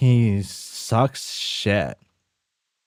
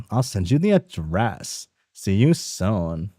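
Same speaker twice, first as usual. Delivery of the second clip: ordinary talk, nothing marked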